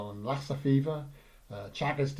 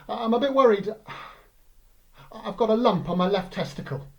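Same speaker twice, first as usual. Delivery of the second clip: panicky; 8 semitones higher, 6 dB louder than usual. Retold or imitated. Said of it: "phoned me up in a real panic".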